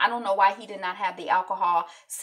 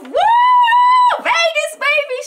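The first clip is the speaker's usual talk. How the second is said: high, loud party yell; excited whoop; 16 dB louder, much slower than usual. Retold or imitated. Beth hanging out the sunroof in Vegas, shouting for joy. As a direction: scream it out the sunroof. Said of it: "She's excited".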